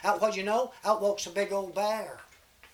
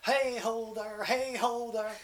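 hollering; 4 semitones higher, slower than usual. Acted. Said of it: "running along there hollering".

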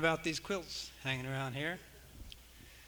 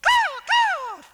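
very high, loud crow squawk; piercing falsetto caws; 9 dB louder than usual. Acted. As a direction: screech it out like a crow. Crow's cry inside children's song.

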